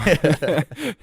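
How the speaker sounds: quick high laugh; high burst of laughter. Real, not acted. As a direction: laugh out loud, high and bright. Laughs at own joke.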